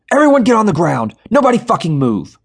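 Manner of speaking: loud, high armed robber shout; yelled. A narrator's reading. Robber yelling at terrified bank customers, loud shout. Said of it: "Darren Smith yelled".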